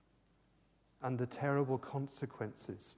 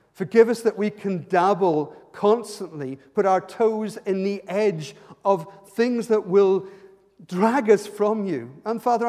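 loud, higher pleading prayer; fervent prayer; 5 semitones higher, 11 dB louder than usual. Acted. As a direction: cry out to God for forgiveness. Praying aloud with force.

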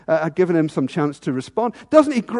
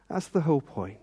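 quiet repeat; 4 semitones lower, 10 dB quieter than usual. Real, not acted.

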